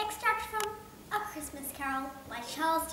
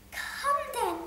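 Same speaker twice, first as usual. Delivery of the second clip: put-on higher, slow, amused young nephew; cheerful nephew; 12 semitones higher, 6 dB louder than usual. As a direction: tease the grumpy uncle.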